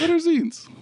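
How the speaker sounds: high squeaky question; high mock voice. Shown as a demonstration. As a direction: squeak it out as a joke.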